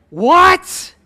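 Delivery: high, loud burst of disbelief; incredulous yelp. Acted. Jack told by God to let go, incredulous yelp. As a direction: shoot the question up high in shock.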